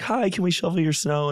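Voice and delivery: high, bright, sing-song door-to-door pitch; eager kid. Retold or imitated. Boy offering to shovel a neighbor's snow, high and eager. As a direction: sell it like a polite eager kid.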